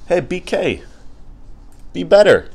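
high, loud "be better" callout; playful reprimand; mock scolding. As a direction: wag a finger at BK. Scolding Burger King jokingly.

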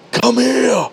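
high mock battle cry at a weight; imitated yell. Mimicking lifter yelling to the weight, high yell. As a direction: holler it like an overhyped lifter. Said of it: "He yelled to the weight".

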